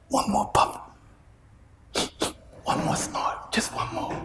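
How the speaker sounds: Shaitan whispering. Retold or imitated. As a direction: coax them quietly like the devil on a shoulder. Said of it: "He whispers".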